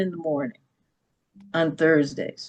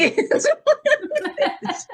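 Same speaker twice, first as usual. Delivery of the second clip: a burst of high laughter that falls away. Real, not acted. Laughing at being wheeled in for the wrong surgery, high laugh. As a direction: laugh out high at the absurd mix-up.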